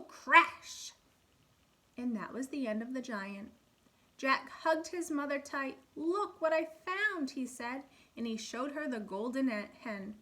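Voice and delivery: put-on lively storybook reading for kids; animated read-aloud for first graders; reading to children. A narrator's reading. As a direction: read it like a picture book at storytime. Teacher reads fairy tale aloud.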